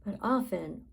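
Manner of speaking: usual speaking voice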